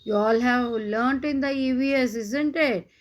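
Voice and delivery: ordinary delivery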